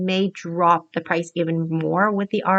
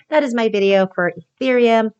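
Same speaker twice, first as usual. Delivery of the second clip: excited outro; 2 semitones higher, 8 dB louder than usual. Real, not acted. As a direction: pump up the viewers for subscribing. Upbeat video sign-off to viewers.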